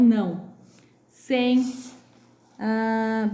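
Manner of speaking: usual speaking voice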